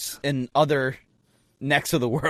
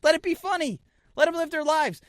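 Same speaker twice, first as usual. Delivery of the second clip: high preachy advice; 10 semitones higher than usual. Real, not acted. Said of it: "mock-preachy advice, pitch very high".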